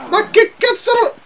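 very high-pitched disbelief; incredulous. Real, not acted. Gamer baffled by the battle, incredulous squeal. Surprised by a game event.

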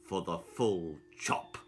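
low, slow and gloating; sinister barber. A narrator's reading. Barber telling Tanya her fate, low and gloating. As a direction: savour every word, menacing.

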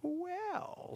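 high, sly drawn-out well; a spoiled cat about to share gossip. Acted. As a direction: start slyly like a scheming cat. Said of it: "in his usual, usual sly voice".

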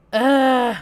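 loud fed-up ugh; frustrated groan. Real, not acted.

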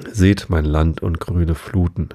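ordinary delivery, nothing marked

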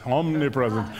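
usual speaking voice

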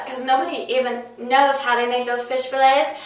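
normal talking voice

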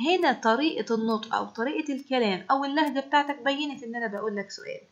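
ordinary delivery

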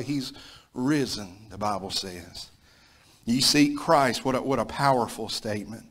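ordinary delivery

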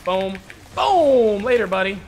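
loud triumphant gamer shout; excited hype. Real, not acted. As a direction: celebrate the kill loudly. Gets the kill.

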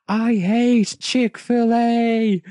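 high, slow shouted line; mock outraged scream, drawn out. A narrator's reading. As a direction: scream your hatred of the place. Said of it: "Elec Man screamed".

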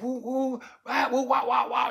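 high-pitched "woo wah" jabber; high mock trash-talk sounds; imitated dissing noises. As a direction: mimic a stream of insults as nonsense. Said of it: "saying the most offensive shit".

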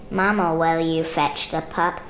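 usual speaking voice

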